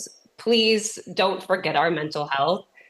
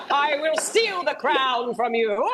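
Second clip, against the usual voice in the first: slow, laughing mock villain threat, pitched higher; cartoon villain; 7 semitones higher, slower than usual. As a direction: gloat like a cartoon baddie mid-cackle. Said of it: "laughing like a cartoon villain".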